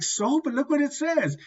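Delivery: acting out a helpless protest; incredulous protest. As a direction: squeal it in retold disbelief. Retold protest to friend.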